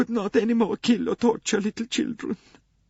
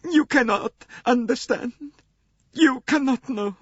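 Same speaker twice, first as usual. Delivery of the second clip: much higher, tearful sobbing voice; weeping; 3 dB louder than usual. Acted. Swedish nurse confessing to Poirot, weeping. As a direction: cry the words out in grief. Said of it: "wept the wretched woman".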